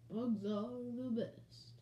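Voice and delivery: slow, rounded, drawn-out croon. Shown as a demonstration. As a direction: stretch the vowels in a slow drawl. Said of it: "I'll imitate my [name] voice".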